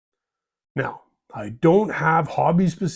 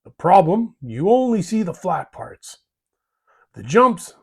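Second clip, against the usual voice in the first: ordinary talk, nothing marked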